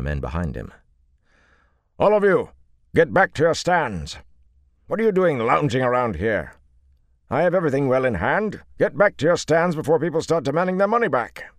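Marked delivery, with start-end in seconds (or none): 2.0-11.4 loud, high-pitched shouting. Acted. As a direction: bellow it at the crowd. Showman rounds on his men.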